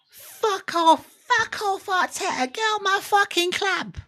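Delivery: very high, ranting London fan; hammed-up London accent, outraged falsetto. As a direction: squeal it out like a mock London football fan. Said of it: "like, a London accent".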